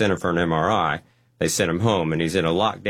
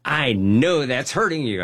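insistent nurse; 6 semitones higher, slower than usual. Retold or imitated. The nurse repeats herself to him, insistent. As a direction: press it home the second time.

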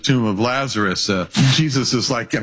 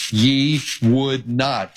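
ye would not, each word slowed and set apart; slow weighted phrase; much slower than usual. Acted. Preacher quoting Jesus, ye would not drawn out slowly. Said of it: "Matthew twenty-three thirty-seven".

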